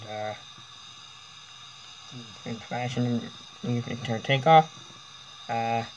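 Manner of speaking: mock captain PA announcement; calm airline captain voice; pilot announcement. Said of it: "stupid little pilot message".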